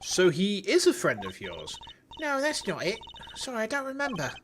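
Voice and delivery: put-on high, chirpy Cockney girl voice; high-pitched Cockney girl. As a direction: squeak it out like a Cockney kid. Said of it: "giving Trucy that high-pitched Cockney voice".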